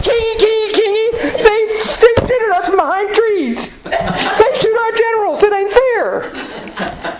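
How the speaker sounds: very high, slow, crybaby voice with laughter; mock whiny redcoats. Teacher mocking British soldiers complaining about being shot, very high crybaby whine through laughs. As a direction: whine like a spoiled child tattling. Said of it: "They go".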